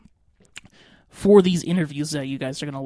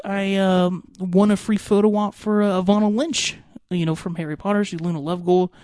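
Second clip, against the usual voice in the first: ordinary talk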